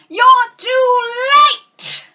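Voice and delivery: high, loud, slow gloating yell; gloating shout. Acted. Ursula gloats at Eric at sunset, loud triumphant shout. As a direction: crow it out triumphantly. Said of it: "gloated Ursula".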